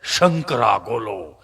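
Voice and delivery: low, slow drawn-out hawker cry; lightly voiced ice-lolly seller; vendor calling out. Ice-lolly seller calling to buyers, low and drawn out.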